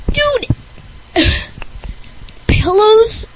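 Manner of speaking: high, exasperated yelp then sigh; disbelieving outburst. Real, not acted. Host disgusted that pillows are cool, high yelp. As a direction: react like it's absurd.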